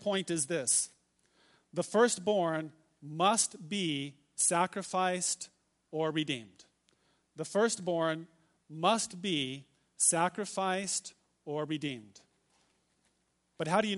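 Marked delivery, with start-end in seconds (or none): 1.7-6.7 slow dictation. Shown as a demonstration. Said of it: "if you're writing these down". 7.4-12.2 slow dictation. Shown as a demonstration. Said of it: "if you're writing these down".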